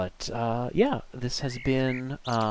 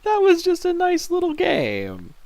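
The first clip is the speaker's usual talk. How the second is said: excited gush; 8 dB louder, slower than usual. Real, not acted. High delighted gush.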